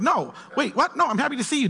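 high-pitched, insistent backpedalling; acted, startled earnestness; flustered protest. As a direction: protest that you were misunderstood and truly meant it. Acting the misread texter protesting.

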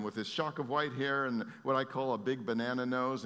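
ordinary talk, nothing marked

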